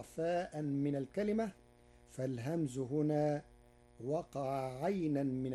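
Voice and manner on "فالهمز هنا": ordinary delivery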